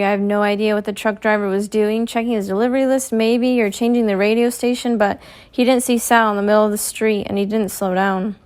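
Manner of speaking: ordinary delivery, nothing marked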